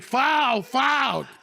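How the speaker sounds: high, loud whiny cries of foul; mock foul cries. Opposing kids crying foul at the ref, high loud whine. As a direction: yell it like kids getting chopped.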